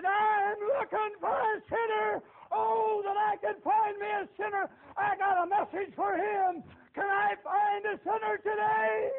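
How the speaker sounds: high, loud, slow crying call; loud, high, tearful-edged street preacher call; preaching yell. Retold or imitated. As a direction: call out across the field. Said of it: "started yelling and screaming and preaching".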